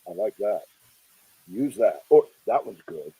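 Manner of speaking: low, soft Sid Caesar approval; imitating Sid Caesar. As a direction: mimic the boss murmuring his okay, low and quiet. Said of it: "Sid would go".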